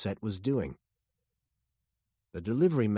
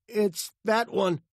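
high, loud, slow reply; nervous high voice at gunpoint; frightened answer; 12 semitones higher, 5 dB louder, slower than usual. A narrator's reading.

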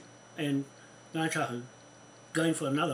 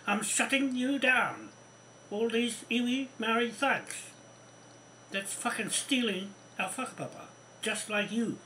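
furious declaration; 7 semitones higher than usual. Real, not acted. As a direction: spell out the threat word by word, seething.

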